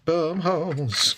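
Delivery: very slow, savoring each word; slow drawn out. Real, not acted. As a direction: drag the words out slowly. Repeats phrase slowly.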